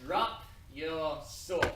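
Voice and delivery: very slow, loud command; slow menace. Acted. Rising hero slowly ordering the prince to disarm. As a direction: draw each word out like a threat.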